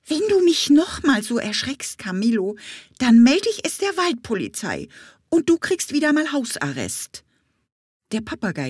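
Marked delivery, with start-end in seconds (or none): 0.1-7.2 high, raised bee voice scolding; scolding threat. Acted. Bumblebee threatening the parrot with house arrest, cross and high. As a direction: threaten him with the forest police.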